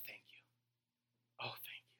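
breathy hushed gratitude; whispered thanks. Retold or imitated. Shaken believer thanking God, whispered. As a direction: barely whisper it. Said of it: "And then you're like".

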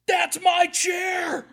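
put-on high, loud, slow childish shriek; possessive girl yelling. Girl at face painting shrieks at preacher, slow high yell. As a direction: claim the chair like a bratty kid. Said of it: "And one girl".

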